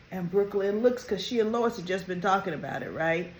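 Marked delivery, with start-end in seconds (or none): none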